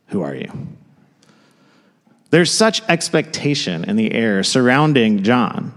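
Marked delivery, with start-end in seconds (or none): 0.1-0.5 low, quiet demand; a hint of interrogating priests; low and hushed, pressing; stern low question.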